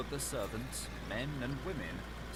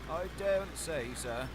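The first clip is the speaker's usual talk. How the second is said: afraid; 7 semitones higher, 3 dB louder than usual. A narrator's reading. Terrified butler refuses.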